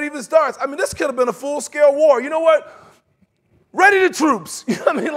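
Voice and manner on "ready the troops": loud, high command; mock battle order. Preacher acting the worked-up king, loud battle order. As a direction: call out to the army. Acts king overreacting to letter.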